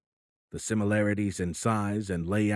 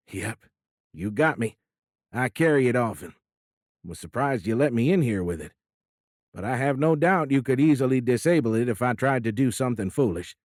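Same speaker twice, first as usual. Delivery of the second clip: louder, good-humoured Midwestern president; an old politician owning up with a grin; folksy president; 3 semitones higher, 5 dB louder than usual. Acted. President admitting his hidden pistol to the admiral, folksy and loud. President confesses carrying a gun.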